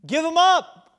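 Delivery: high, loud "give 'em up"; blunt command. Acted. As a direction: snap the answer back. John's blunt answer, acted.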